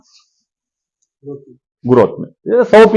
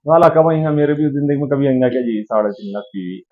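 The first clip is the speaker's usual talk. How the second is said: soft, low mock daydreamer; दूर का सपना; 4 semitones lower, 7 dB quieter than usual. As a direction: imitate a doubter wondering quietly to himself. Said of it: "किसी के लिए ... तो सपना है". (धीमी आवाज़ में मन की बात)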